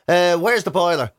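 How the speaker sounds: ordinary talk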